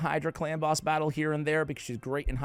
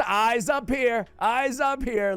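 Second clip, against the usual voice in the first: mock scolding; 8 semitones higher, 3 dB louder, slower than usual.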